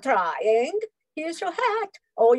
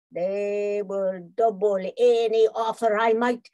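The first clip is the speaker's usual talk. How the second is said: usual speaking voice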